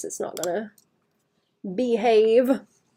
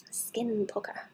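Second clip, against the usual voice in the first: whisper; 9 dB quieter, slower than usual. Real, not acted. Whispered aside.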